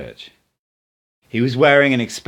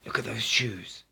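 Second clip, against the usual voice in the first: hushed, much softer aside; whisper; 10 dB quieter than usual. Acted. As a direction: still whispering, pointing out the shoes. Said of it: "Don Juan said in a whisper".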